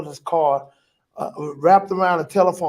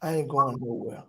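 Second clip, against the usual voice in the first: softer, lower loving reassurance; tender reassurance; 5 semitones lower, 7 dB quieter, faster than usual. Retold or imitated. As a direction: comfort him gently as his wife. Wife comforts him.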